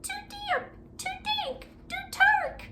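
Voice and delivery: very high squeaking little mouse; tiny mouse squeak; squeaky mouse. Acted. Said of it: "Mouse squeaks".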